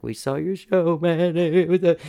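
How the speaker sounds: high-pitched imitation of weeping fans; mock tearful. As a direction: put on a high, crying voice, retelling it. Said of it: "they both just start crying".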